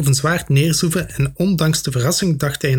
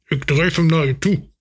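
gedempt gemompel; 2 semitones higher than usual. A narrator's reading. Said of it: "mompelde een gedempte stem".

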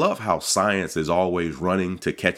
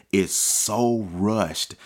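slowed down; 2 semitones higher, much slower than usual. Shown as a demonstration. Slowing on rushed.

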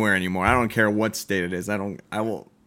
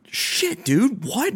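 retold shock, voice leaping high; high, disbelieving reaction to bad news; 13 semitones higher, much slower than usual. Retells shock at boss's warning.

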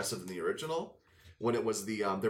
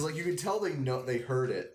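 ordinary talk, nothing marked

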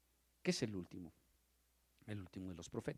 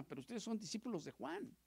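higher, rising reproach; raised reproachful voice; rebuke; 10 semitones higher, 9 dB louder than usual. Acted. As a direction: scold them pointedly. Jesus rebuking John's disciples.

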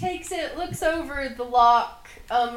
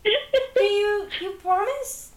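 hopeful goblin; 8 semitones higher, slower than usual. Acted.

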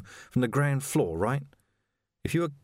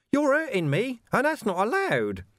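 high, put-on hurt whine; mock whine; 13 semitones higher than usual. A narrator's reading. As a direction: play the victim, high and sly. Carcer whining mockingly.

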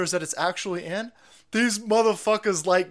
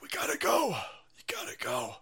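whisper; 6 semitones lower, 10 dB quieter than usual. Real, not acted. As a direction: hiss it quietly so nobody hears. Urging someone off camera.

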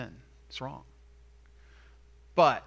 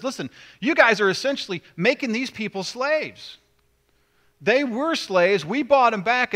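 higher, louder reproach of the nobles; stern rebuke; 6 semitones higher, 6 dB louder than usual. Acted. Nehemiah rebuking nobles in public, stern indignation. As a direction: confront them with rising indignation.

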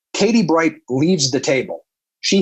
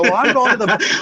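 pitched up and flustered; high nervous exit; 7 semitones higher than usual. Retold or imitated. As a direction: bail out with a nervous laugh. Escaping the awkward table.

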